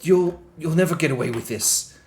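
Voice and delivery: high, loud frightened curse; panicked outburst. Acted. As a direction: spit it out in scared fury. Terrified mayor caught, curses them.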